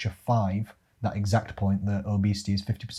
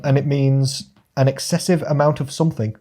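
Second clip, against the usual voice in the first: usual speaking voice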